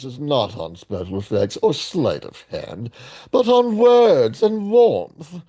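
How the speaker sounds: grand put-on recital with swooping pitch; theatrical read-aloud. Reading the film's rationale aloud, theatrical put-on voice. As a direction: declaim the citation, swelling on key words.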